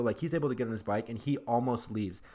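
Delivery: ordinary delivery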